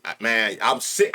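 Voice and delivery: usual speaking voice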